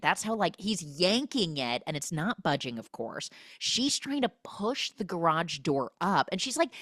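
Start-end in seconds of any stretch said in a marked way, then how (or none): none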